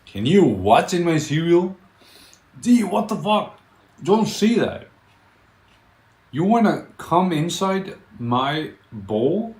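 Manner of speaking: high, loud, disbelieving; shocked. Real, not acted. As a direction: react like it's outrageous. Shocked outburst at chat message.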